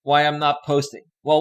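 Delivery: ordinary delivery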